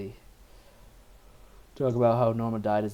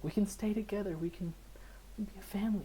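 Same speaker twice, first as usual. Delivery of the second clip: higher, soft and coaxing; hopeful wife; 10 semitones higher, 5 dB quieter, faster than usual. Retold or imitated. Wife persuading her husband to stay, soft coaxing voice. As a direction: reassure him you can be a family. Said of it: "now she's like".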